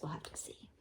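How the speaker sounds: whispered teaser to the class; hushed suspense. Real, not acted. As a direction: whisper it like a secret. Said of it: "teacher whispers suspense".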